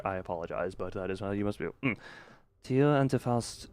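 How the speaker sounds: low, very soft character apology. Acted.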